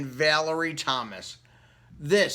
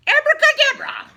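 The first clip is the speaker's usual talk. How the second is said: high, loud sing-song witch cry; witch casting a spell; 12 dB louder than usual. Acted. Witch waving her wand at her cat, loud high spell. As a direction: fling the magic word out like a cackling witch. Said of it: "and said".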